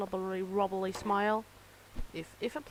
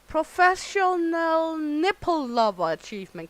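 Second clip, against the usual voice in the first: louder, higher proclaiming read; announcing achievement; 6 semitones higher, 7 dB louder than usual. Real, not acted. Player reading out a new achievement, proud announcement. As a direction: trumpet the title like a prize.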